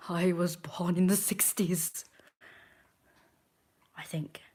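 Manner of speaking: slow whispered confession. Real, not acted. Vlogger admitting her age to viewers, whispered. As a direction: whisper it like a secret.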